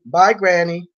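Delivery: ordinary talk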